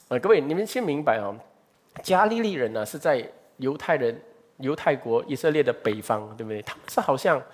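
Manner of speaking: normal talking voice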